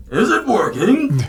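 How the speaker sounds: high, slow robot voice; Sir Bo's machine voice. Acted. Sir Bo checking the device, high, slow machine voice. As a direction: ask it loudly, slow and high-pitched. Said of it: "and then you hear Sir Bo".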